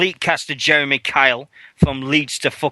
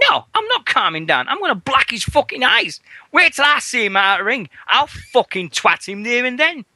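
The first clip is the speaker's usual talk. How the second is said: retold angry rant; 10 semitones higher than usual. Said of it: "I had a proper Jackie moment".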